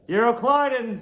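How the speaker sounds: a touch of a pompous rich man; old Dives. Acted. Reader voicing old Dives in his silken wrapper, lightly pompous. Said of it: "says old Dives".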